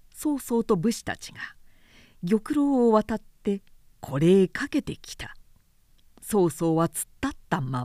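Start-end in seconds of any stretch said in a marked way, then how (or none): none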